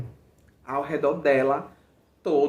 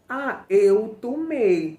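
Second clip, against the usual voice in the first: slow, higher and louder, delicate little Xuxa voice; 6 semitones higher, 5 dB louder, much slower than usual. Retold or imitated. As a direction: imitate a dainty children's TV host, drawn out. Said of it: "a voz dela era tipo assim, a voz da Xuxa".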